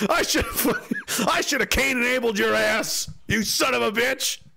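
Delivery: high, strained comic rant; mock outrage. Telling off Canada as a betrayer, mock outrage.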